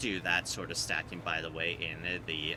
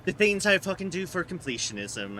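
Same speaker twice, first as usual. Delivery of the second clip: exasperated exclamation; 10 semitones higher, 5 dB louder than usual. Real, not acted.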